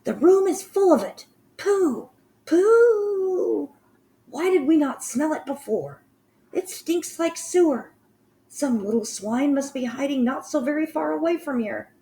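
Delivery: high, screechy witch voice in revulsion; disgusted witches. Acted. Witches complain of the smell, high and revolted. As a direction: spit out the stink with loathing.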